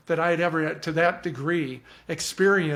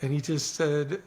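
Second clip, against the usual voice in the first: usual speaking voice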